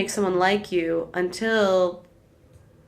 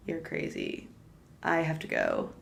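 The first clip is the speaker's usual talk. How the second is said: very soft, withdrawn quote; hushed retort; 3 semitones lower, 9 dB quieter than usual. Retold or imitated. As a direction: retell it almost under the breath. Retelling her reply to drunk confession.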